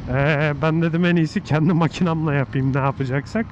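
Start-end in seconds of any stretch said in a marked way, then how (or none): none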